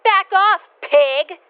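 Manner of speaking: loud snarl at the cop; yelling. Acted. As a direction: shout it, loud and contemptuous. Becky attacks the mall cop.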